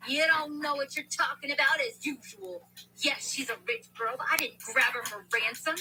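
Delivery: sharp loud rebuke, rising; angry. Acted. Walter silences Ryan in front of the gang, angry. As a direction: shut the complainer down hard. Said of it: "That got Walter mad".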